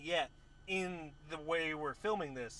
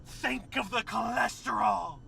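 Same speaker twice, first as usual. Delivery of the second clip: shrieking witch; 6 semitones higher, 19 dB louder, slower than usual. Retold or imitated. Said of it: "the witch goes".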